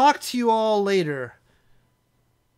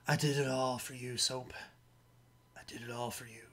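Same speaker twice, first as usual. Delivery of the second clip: quiet, lower, half-hearted stab at Price's British voice; mock-tender Price accent murmured to Soap, softer and lower; attempted Price accent; 5 semitones lower, 12 dB quieter than usual. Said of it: "I was trying to get, like, one of those Price, uh, accent".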